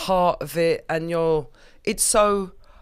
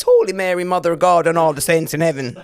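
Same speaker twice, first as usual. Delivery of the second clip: a light mock Irish accent, louder, laughing at the end; 5 dB louder, faster than usual. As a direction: put on an Irish accent, hammed-up. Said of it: "The only thing I think I can say in an Irish accent is".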